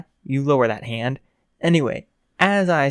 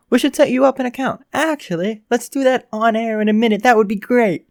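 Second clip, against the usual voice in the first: mildly eager radio host, pitched up; lightly hammed-up enthusiasm, selling the idea; excited host; 7 semitones higher, 4 dB louder than usual. Radio host pitching a Twitter account to Canary, eagerly.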